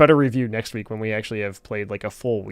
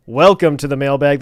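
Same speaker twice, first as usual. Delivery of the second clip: ordinary talk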